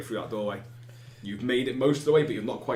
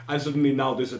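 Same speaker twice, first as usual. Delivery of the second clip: ordinary talk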